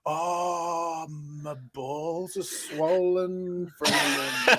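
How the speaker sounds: slow, soft, drawn-out mock moan; feigned suffering. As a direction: groan it out like a badly faked ailment. Man faking ailment as excuse.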